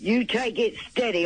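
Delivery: usual speaking voice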